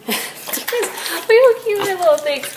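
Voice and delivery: high, slow cooing through a laugh. Real, not acted. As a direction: gush over the cute pig with a laugh. Cooing over pig.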